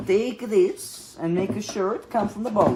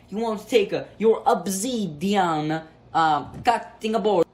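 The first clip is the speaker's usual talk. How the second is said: ordinary talk, nothing marked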